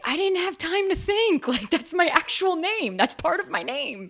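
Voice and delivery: high, loud, exaggerated exasperation; indignant protest. Actor defending her own name to critics, indignant protest.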